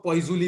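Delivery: "poisuli" said with extra syllables, poy-zoo-lee; long pronunciation "poisuli". Shown as a demonstration. Teacher showing students what some people say, "poisuli" as poy-zoo-lee. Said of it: "कई लोग इसको poisuli भी बोलते हैं".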